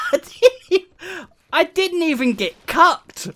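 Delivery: breaks into high laughter; laughing. Real, not acted. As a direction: laugh so hard the words break. Cracks up mid-sentence.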